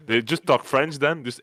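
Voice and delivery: normal talking voice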